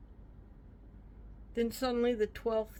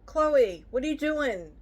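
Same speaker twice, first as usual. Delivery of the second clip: calling out; 6 semitones higher, 6 dB louder than usual. Real, not acted. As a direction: call across the room to stop her. Mom calls out to her child.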